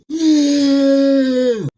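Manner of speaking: long high piercing scream; distant scream. Acted. Victim screaming in the dark woods, long and piercing. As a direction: let out a shrill far-off scream. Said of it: "I hear a loud screaming take place".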